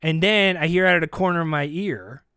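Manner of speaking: ordinary talk, nothing marked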